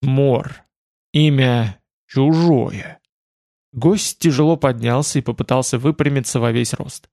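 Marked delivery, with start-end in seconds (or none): 0.0-3.0 slow, halting, gurgling voice; words forced out of a gurgling throat; gurgling creature voice. Acted. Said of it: "извлёк клокочущие звуки из глотки".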